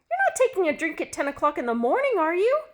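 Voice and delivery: high, fast, loud shocked question; shocked wife. Acted. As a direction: catch him out, scandalized. Wife shocked he's drinking early.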